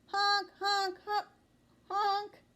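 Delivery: high, loud honking horn; horn honks. A narrator's reading. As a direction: blare it like a car horn. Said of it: "But then he hears".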